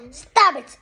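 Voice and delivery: loud, sharp telling-off; shouted order. A narrator's reading. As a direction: break up a squabble. Parent breaking up children's fight.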